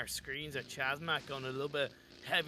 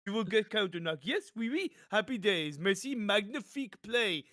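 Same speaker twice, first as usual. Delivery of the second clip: a jokey, hammed-up French accent, slightly higher; mock French accent; 4 semitones higher, 3 dB louder than usual. Caster mocking a player's self-grenade as a French genius, hammed-up French accent. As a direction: play a pro gamer in an over-the-top French accent. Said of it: "in his French accent".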